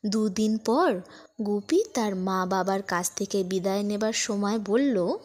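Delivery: ordinary delivery, nothing marked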